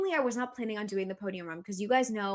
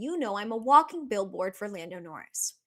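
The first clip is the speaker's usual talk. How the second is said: ordinary talk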